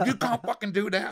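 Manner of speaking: hammed-up angry band member; imitating outraged singer. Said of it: "band objects to photo".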